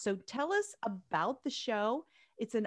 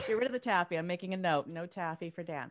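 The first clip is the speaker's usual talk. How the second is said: ordinary talk, nothing marked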